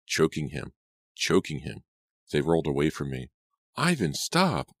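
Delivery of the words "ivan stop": much higher, drawn-out shriek. A narrator's reading. Martha shrieking at Ivan mid-fight. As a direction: scream at him to stop. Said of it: "I shrieked at the top of my lungs".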